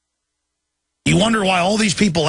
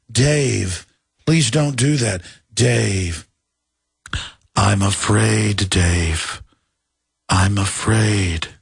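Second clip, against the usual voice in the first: lower, slow calm computer voice; HAL 9000; 6 semitones lower, much slower than usual. Retold or imitated. Host imitates the calm HAL computer. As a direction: drawl it out like a calm machine. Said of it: "Remember two thousand and one Space Odyssey?".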